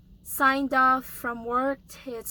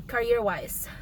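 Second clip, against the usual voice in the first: normal talking voice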